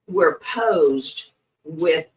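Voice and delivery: ordinary talk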